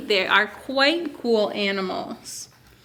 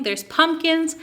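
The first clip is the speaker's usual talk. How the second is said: usual speaking voice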